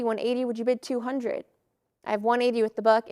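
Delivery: ordinary talk, nothing marked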